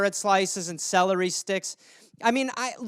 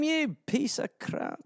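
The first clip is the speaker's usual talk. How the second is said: slow Scottish mutter, much softer; muttered Scottish aside; under his breath; 4 semitones higher, 9 dB quieter, slower than usual. Acted. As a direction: grumble the insult under your breath in Scottish. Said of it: "talks under his breath".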